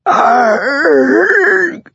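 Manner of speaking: piercing high scream of agony; agonized scream. A narrator's reading. Stabbed mob boss screaming in agony. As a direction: scream as the blade hits. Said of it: "approximation of the sound Marcosa made".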